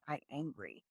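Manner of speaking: put-on soft, small toddler voice; small angry child. Mom imitating her toddler naming her feeling, soft and small. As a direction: mumble it like a sulky little kid. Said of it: "She'll go".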